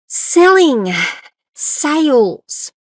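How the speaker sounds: loud and high, punched out; emphatic. Real, not acted. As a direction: hit each word hard. Emphatic topic words.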